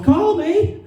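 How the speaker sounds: high-pitched mock yell. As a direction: call out like a mom from a car window. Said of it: "rolls down the window and she yells".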